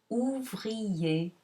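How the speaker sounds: "boulanger, entier, ouvrier, dernier, parler, étudier" one by one, endings as closed é, R silent; final -er as é. Shown as a demonstration. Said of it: "is pronounced E".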